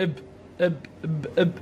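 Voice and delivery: "اب" closed on a vowelless b; sukun, no vowel. Shown as a demonstration. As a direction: stop "اب" on the b, adding no vowel after it. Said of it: "هاي حركة السكون الدائرة".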